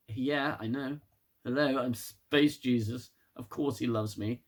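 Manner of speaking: low, quiet, smug sarcasm; mock her as a self-satisfied saviour; sarcastic Burnham. Mocking Burnham to viewers, low quiet smugness. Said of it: "and Burnham's like".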